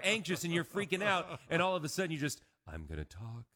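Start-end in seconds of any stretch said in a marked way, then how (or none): 2.7-3.5 mock-soothing public radio critic; NPR food critic. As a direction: put on a hushed public radio purr. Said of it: "like I'm a food critic on N P R".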